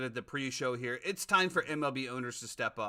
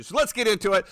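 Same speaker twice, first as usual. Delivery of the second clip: higher, punchy host hype; sports show intro; 7 semitones higher, 4 dB louder than usual. Real, not acted. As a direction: kick off the recap with big energy. Host launches into NFL recap.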